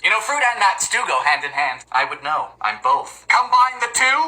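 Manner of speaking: ordinary delivery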